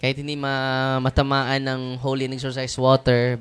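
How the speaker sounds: ordinary delivery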